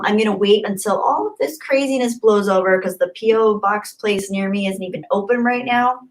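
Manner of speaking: ordinary talk, nothing marked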